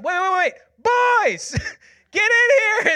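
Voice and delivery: high, loud acted holler; urgent calling out. Mom stops the prayer to call the boys, hollering. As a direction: imitate a mom hollering for the kids.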